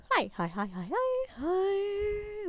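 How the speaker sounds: hunting for the right voice; voice testing. Shown as a demonstration. Player testing pitches to find the character voice, soft. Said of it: "not doing the correct voice".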